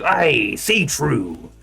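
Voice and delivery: loud, drawn-out pirate growl. Shown as a demonstration. Streamer demonstrating the pirate character from the campaign, loud pirate voice. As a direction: call it out slowly as a pirate. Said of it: "he's a pirate. And he goes".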